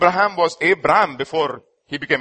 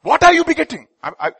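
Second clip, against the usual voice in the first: normal talking voice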